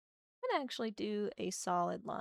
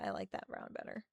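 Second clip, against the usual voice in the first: muttered aside; 13 semitones lower, 8 dB quieter, faster than usual. Real, not acted. Murmuring to self while drawing.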